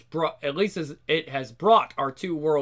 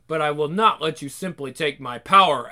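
usual speaking voice

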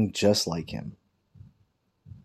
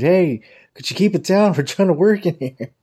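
high, loud joking group outcry; mock scream; 9 semitones higher, 6 dB louder than usual. Whole office screams at the boss's fart, mock outrage. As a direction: holler it in a high, hammed-up protest. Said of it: "everybody screamed".

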